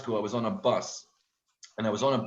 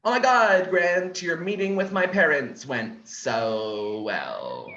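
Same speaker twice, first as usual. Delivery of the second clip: girlfriend impression; 6 semitones higher, 6 dB louder, slower than usual. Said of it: "she'll say things like".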